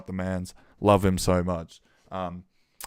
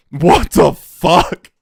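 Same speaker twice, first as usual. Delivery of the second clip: amazed; 6 semitones higher, 9 dB louder, much slower than usual. Real, not acted. Shocked by the drop again.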